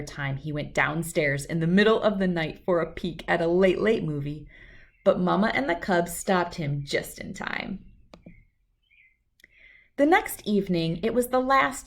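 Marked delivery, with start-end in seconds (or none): none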